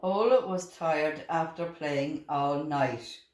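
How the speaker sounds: plain reading voice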